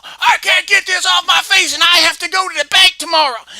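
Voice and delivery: loud, high angry yell; furious mom ranting; mad shout. Acted. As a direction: mom yelling about her marked-up face. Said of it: "my mama was so mad at me".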